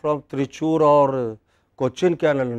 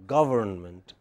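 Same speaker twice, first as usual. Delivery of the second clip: "government" with main stress on GA, softer; 6 semitones lower, 5 dB quieter than usual. Shown as a demonstration. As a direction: hit GA in "government". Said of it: "Main stress on ga".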